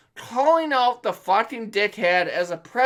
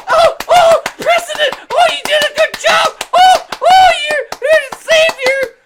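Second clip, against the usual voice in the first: much higher, louder gushing falsetto; mock the sycophants with shrill adoration; mocking fawning fans; 15 dB louder than usual. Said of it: "and everyone's like".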